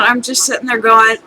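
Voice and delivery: ordinary talk, nothing marked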